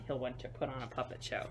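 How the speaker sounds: plain reading voice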